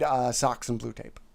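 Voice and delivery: hushed unvoiced aside; whispering. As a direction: whisper the product names.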